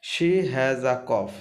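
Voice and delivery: words said slowly and clearly; slow classroom dictation; slow reading. Shown as a demonstration. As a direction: read it slowly so students follow. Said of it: "teacher reads sentence slowly".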